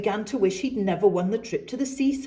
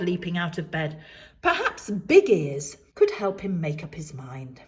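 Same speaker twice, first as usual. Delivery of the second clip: ordinary delivery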